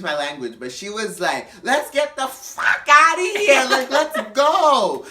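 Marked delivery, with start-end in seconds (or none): 1.6-5.1 high, loud, drawn-out holler; impatient mock drunk, shouting to leave; drunken demand. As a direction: stretch every word in a drunk yell.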